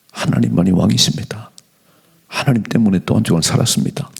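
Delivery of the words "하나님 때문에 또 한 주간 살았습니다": usual speaking voice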